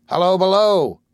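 loud call down the hatch, pitched high. A narrator's reading. Captain calling down the hatch, loud hail. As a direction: shout it down into the hold. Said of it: "he cried down the open hatch".

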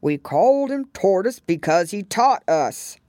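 loud, exasperated turtle voice; angry Mock Turtle. A narrator's reading. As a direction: snap it out in annoyance. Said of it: "said the Mock Turtle angrily".

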